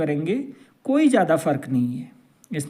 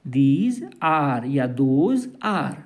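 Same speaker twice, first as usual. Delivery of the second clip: very slow drill; much slower than usual. Shown as a demonstration. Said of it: "प्रयोग करना है यहाँ पर are का".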